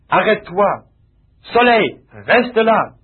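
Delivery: fort, haut et très lent sur « reste là »; détache chaque mot comme un ordre; ordre au soleil. Retold or imitated. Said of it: "Il parla au soleil". (Josué commande au soleil, lentement et avec force)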